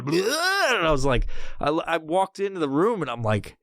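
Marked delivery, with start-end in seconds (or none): 0.0-0.7 high-pitched mock "bleh"; goofy babble noise. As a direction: blurt a silly wobbling noise as the wacky scientist. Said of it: "he's, like, going".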